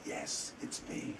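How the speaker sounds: breathy whispered ghost voice, very soft; a ghost whispering from the wall; ghostly whisper. Retold or imitated. Ghost voice in told story.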